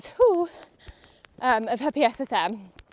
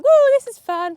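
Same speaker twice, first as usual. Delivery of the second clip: high, loud whoop of glee; playful horse's voice; 12 semitones higher, 10 dB louder than usual. Acted. Rider voicing her over-fresh horse, gleeful whooping. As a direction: whoop it like an excitable horse having fun. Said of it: "She's just like".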